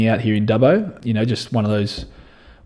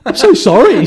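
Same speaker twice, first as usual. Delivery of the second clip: high, louder, laughing mock apology; imitating her laughing apology; 14 semitones higher, 8 dB louder than usual. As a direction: play her giggling sorry in a squeaky voice. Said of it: "She's like".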